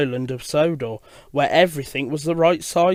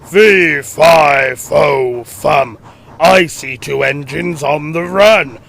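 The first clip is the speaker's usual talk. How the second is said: loud booming giant chant; thunderous fairy-tale giant voice; 5 dB louder, slower than usual. Acted. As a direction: boom it out slowly, like a giant on the hunt. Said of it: "His thunderous voice boomed".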